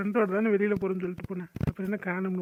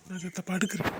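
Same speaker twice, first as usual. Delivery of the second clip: usual speaking voice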